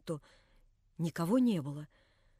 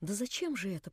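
a hushed, soft-voiced question; whispered; 3 semitones lower, 8 dB quieter than usual. A narrator's reading. Natasha to Alyosha, a quiet near-whisper. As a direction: barely breathe it out. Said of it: "прошептала Наташа".

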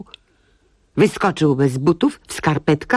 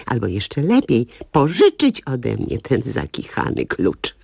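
ordinary delivery

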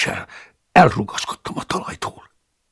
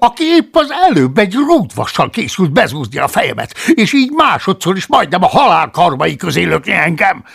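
rising voice; 6 semitones higher, 6 dB louder than usual. Acted. Paulus questioning the monk, voice rising. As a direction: let the voice climb. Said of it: "egyre emelkedő hangon".

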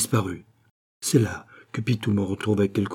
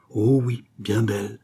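choked voice. A narrator's reading. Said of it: "d'une voix étranglée".

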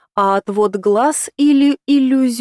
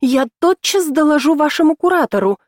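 angry; 6 semitones higher than usual. A narrator's reading. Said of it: "звенел от злости".

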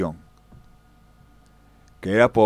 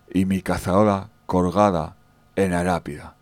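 normal talking voice

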